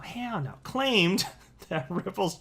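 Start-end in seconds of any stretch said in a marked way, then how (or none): 0.0-0.5 "Hell no" tossed off softer and higher. Shown as a demonstration. Repeating the handle "Hell no" once more, softer and amused. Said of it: "I'm just gonna say it like that from now".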